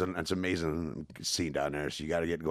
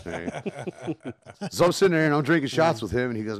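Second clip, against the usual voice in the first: usual speaking voice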